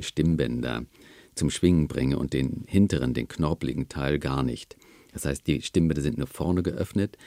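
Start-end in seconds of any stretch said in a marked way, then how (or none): none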